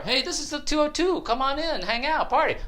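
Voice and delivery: high-pitched party host voice; put-on high voice, casual invitation; inviting neighbour. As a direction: wave them in with a high, easygoing call.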